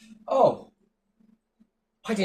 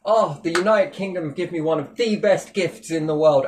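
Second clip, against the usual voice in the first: ordinary talk, nothing marked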